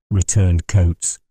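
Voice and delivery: plain reading voice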